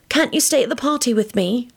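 high, plaintive plea; forlorn. A narrator's reading. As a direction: forlorn girl's request. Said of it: "asked him forlornly".

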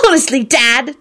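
high exasperated squeal. A narrator's reading. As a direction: squeal it at your dad. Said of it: "Ginny squealed".

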